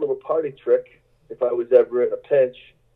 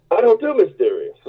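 higher, firm mock host line; 8 semitones higher than usual. Musician imitating the host rejecting mysterious. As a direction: toss it back as the blunt host. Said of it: "And he's like".

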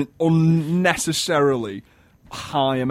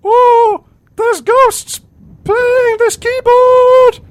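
very high, loud, slow warbling diva; 12 dB louder, much slower than usual. Pretend. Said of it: "it was so high pitched".

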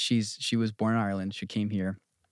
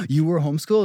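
higher, louder mock astonishment; mock shock; 6 semitones higher, 7 dB louder, faster than usual. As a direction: imitate someone scandalized and amazed. Others' shock at homeschooling voiced.

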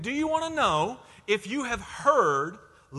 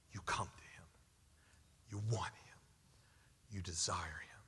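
breathy hushed whisper, much softer; 14 semitones lower, 16 dB quieter than usual. Acted. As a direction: drop to an intimate whisper. The same call, now whispered.

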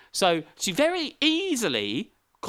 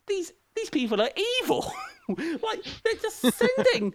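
much higher, shocked outburst with a laugh; horrified squeal. Retold or imitated. Recalling the film's sacrifice plan, horrified high squeal. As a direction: squeak out the outrage in a high put-on voice. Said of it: "I'm like".

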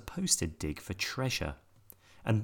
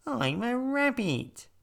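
high, slow bouncy rabbit; 3 dB louder, slower than usual. A narrator's reading. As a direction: speak like a little rabbit. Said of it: "said a creature with long ears".

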